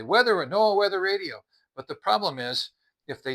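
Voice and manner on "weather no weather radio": high, loud mocking of a product listing; mock sales pitch. Reviewer mocking cheap listings on Amazon, loud high mock pitch. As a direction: parody the seller's big claim, sneering. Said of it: "where they'll say".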